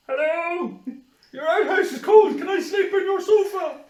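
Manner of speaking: high, loud sing-out through laughter; mock polite caller. Teasing friend about entering a house, high mock caller voice. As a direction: call out like a hopeful night visitor.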